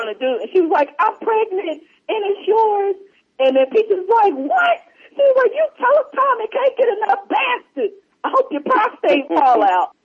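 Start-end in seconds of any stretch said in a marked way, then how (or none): 1.0-2.9 high, breathy-free mock soap actress reveal; soap opera drama. Soap actress telling the dude, in a high mock-dramatic voice. 4.3-4.8 very high mock-shocked what. Peaches stunned by the soap twist, very high mock shock. 5.6-9.9 high, sassy mock rant at the soap; cursing at the TV. Peaches cursing the soap character, high sassy mock rant.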